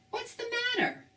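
high-pitched character voice; Big Bird voice. A narrator's reading. Big Bird meets sad Harry, high character voice. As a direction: pitch it way up, concerned question.

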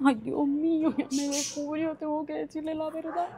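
a nervous private aside, under her breath; worried aside. Acted. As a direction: mutter to herself that she's been found out. Wife's worried aside to herself.

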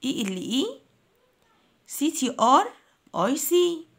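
letters in small groups with pauses between; spelled in chunks. Shown as a demonstration. Teacher shows pupils how to split the word, chunked spelling. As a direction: spell it chunk by chunk, pitch lifting. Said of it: "يا ريت تقسموا الكلمات بنفس الطريقة".

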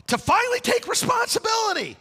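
high shouted climax; preaching shout. Real, not acted. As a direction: preach it out at full force.

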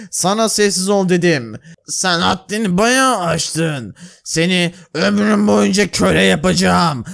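low, slow, threatening guard voice; menacing guard. Acted. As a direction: talk down to the slave coldly. Plays the king's guard. (köleye tehditkâr, alçak sesli muhafız)

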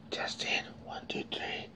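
very soft, voiceless whisper; whispered mic test. Shown as a demonstration. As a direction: whisper the sound check.

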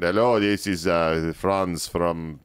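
clumsy put-on German accent that drifts into Russian; bad German accent. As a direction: fake a German herald with a failing German accent. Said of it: "I can't do a German accent".

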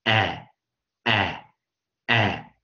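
"哎" as a short, loud, isolated "eh", three times; vowels only. Shown as a demonstration. Teacher drilling a short vowel, loud separate bursts. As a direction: punch out each 哎 short, with a pause after. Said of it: "我们只念元音啊".

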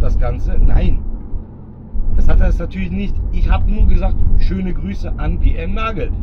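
loud, pumped-up car talk to the camera; hyped loud vlog voice. Acted. Talking to the followers from the car, loud and pumped. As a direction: sell it big and loud to the viewers.

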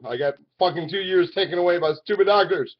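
ordinary talk, nothing marked

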